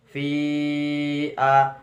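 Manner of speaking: "Fi a" with a long, level ii before the a; mad jaiz munfasil. Shown as a demonstration. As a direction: stretch "Fi" well out, then a short "a". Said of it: "bacaan mad jaiz munfasil". (baca "Fi a" dengan fi dipanjangkan)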